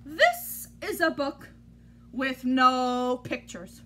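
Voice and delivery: ordinary delivery, nothing marked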